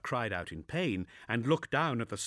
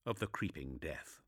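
plain reading voice

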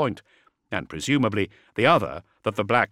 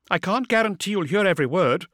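brisk, higher female officer; vox officer voice; 10 semitones higher, 4 dB louder than usual. A narrator's reading. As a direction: report it like a busy comms officer.

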